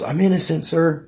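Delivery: high, pleading prisoner's voice. Retold or imitated. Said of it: "he goes".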